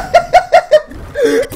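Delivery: short high burst of laughter; real helpless laugh; hysterical laugh. Real, not acted. Laughing at friend's curse.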